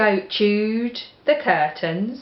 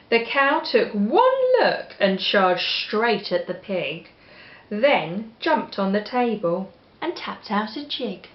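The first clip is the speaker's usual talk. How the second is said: ordinary delivery, nothing marked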